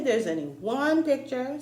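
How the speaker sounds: plain reading voice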